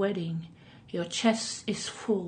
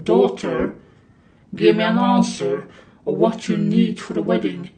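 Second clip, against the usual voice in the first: stern king; 6 dB louder than usual. Acted. King demands answer.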